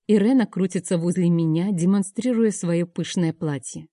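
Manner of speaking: usual speaking voice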